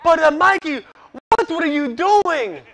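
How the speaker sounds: put-on high, loud Dominican-accented amazement, slowing at the end; thrilled then puzzled, in a Dominican accent; Dominican manager's accent. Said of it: "He's from Dominican Republic".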